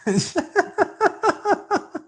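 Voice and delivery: high, burst of loud laughter; big laugh. Real, not acted. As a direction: crack up at the joke. Co-host's joke about blinking.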